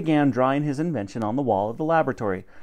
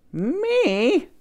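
much higher startled cleaning lady; startled squeak; 14 semitones higher than usual. Acted. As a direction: squeak out her shock. Dottie chosen to fly.

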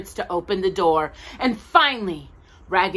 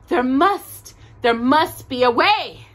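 determined; 7 semitones higher, 7 dB louder, slower than usual. A narrator's reading. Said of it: "said Raggedy Ann".

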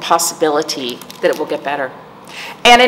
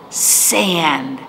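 usual speaking voice

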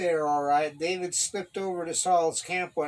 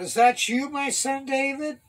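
high, raised questioning call; raised-voice hail; 9 semitones higher than usual. A narrator's reading. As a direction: call across to someone half-recognised. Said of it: "Saul recognized David's voice and called out".

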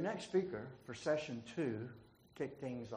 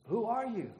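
startled question; 10 semitones higher, 11 dB louder than usual. A narrator's reading. Startled man on cliff.

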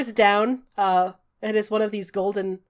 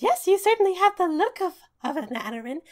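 high, bright fairy voice; squeaky little fairy greeting; tiny pixie voice; 9 semitones higher than usual. Acted. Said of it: "The pixie says".

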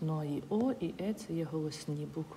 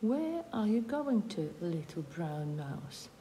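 slow reading; slower than usual. Shown as a demonstration. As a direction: read the owl's question out slowly. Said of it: "reading story line slowly". (повільне читання рядка казки)

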